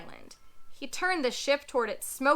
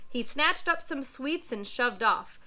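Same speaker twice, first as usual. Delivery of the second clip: ordinary delivery, nothing marked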